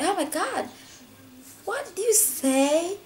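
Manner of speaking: put-on high, slow and shocked at a child's rude word; aghast, drawing out the question. Mother shocked hearing a small kid swear, high aghast. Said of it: "I was like".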